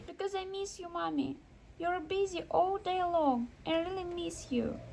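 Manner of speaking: put-on high and hushed, a lonely little boy; boy quietly telling his busy mother he misses her; sad child. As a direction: softly confess missing mommy, like a small kid.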